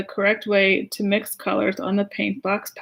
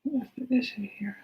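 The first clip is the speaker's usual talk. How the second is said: whisper; 3 semitones higher, 10 dB quieter than usual. Real, not acted. Aside to someone off camera.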